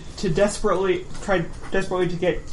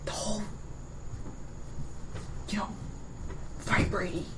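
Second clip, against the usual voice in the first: stage whisper; 2 semitones higher, 8 dB quieter than usual. Acted. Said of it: "stage whisper then".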